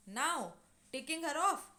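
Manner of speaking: ordinary delivery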